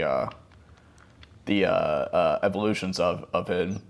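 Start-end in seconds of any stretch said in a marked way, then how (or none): none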